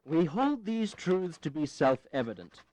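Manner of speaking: slow, deliberate declaration reading; slow formal reading. Acted. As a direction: weigh each phrase as you read it out. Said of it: "I'll try a different version".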